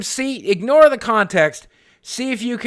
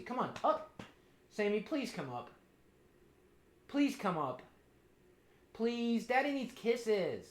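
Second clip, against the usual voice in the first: very quiet begging to a pet; small, coaxing plea; soft pleading; 17 dB quieter than usual. Real, not acted. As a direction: plead gently, barely above a murmur.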